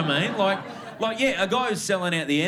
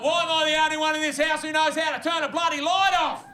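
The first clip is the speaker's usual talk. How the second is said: much higher, louder exasperated yell; dad crying out as rage; 12 semitones higher, 3 dB louder than usual. Retold or imitated. Dad yelling at the house about lights, an exasperated high yell. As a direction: bellow like a fed-up dad at the whole house. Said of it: "This is what it sounds like when dads cry".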